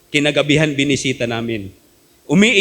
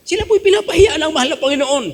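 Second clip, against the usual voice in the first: emphatic preaching; 13 semitones higher, faster than usual. Acted.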